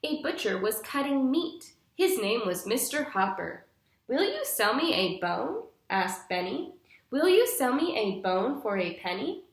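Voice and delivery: bright, clear storybook reading for a class; patient storytime narration for young listeners; reading to children. Acted. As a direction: read it like a picture book to little kids. Teacher reads picture book aloud.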